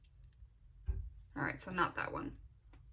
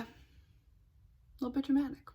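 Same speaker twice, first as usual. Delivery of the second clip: ordinary talk, nothing marked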